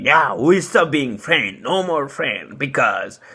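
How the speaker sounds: a lightly put-on voice for the ex; imitating ex-girlfriend. He mimics his ex-girlfriend ending the friendship, lightly put-on voice. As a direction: mimic her brushing him off, half-mocking. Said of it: "she was like".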